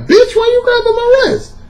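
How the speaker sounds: high, far indignant squeak; mock outrage at her grabbing his wrist; incredulous look. Himself, mock outraged high voice at her. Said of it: "I looked at her like".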